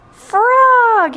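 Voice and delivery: high, loud, drawn-out call; shout. A narrator's reading. Toad hailing Frog from the riverbank, shouting. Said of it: "he shouted".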